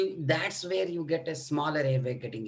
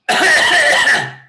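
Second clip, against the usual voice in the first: loud honking trumpet cough; barking cough; 12 semitones higher, 14 dB louder than usual. Shown as a demonstration. Teacher shows a tracheal cough, loud honking bark. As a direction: cough like blowing into a hollow vessel. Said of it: "you should bring that barking cough".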